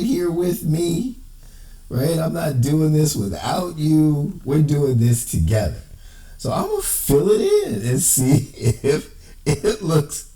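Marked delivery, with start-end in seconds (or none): 6.4-10.3 real laughter breaking into the words; higher voice, struggling through a laugh; apologetic, can't help laughing; laughing while talking. Real, not acted. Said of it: "Excuse me, laughing".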